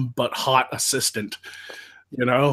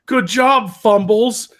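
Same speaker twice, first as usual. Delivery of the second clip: mocking teammates; 6 semitones higher, 7 dB louder, much slower than usual. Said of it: "they're all like".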